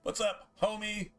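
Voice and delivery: playful shout-out to a buddy's mom; high playful greeting. Real, not acted. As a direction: call it out way up high. That's just James's mom.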